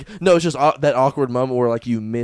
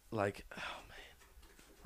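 whisper; 8 semitones lower, 24 dB quieter, much slower than usual. Real, not acted. Speaker whispers sigh.

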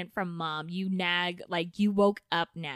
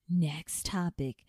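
ordinary talk

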